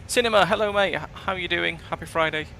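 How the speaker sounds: high, loud cheery hello; excited greeting. Real, not acted. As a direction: greet a friend with a burst of joy. Greeting a viewer in chat.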